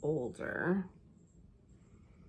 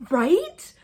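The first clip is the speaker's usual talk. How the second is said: high, loud burst of excited agreement; 10 semitones higher, 12 dB louder than usual. Retold or imitated. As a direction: blurt it high and thrilled. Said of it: "And I'm so excited".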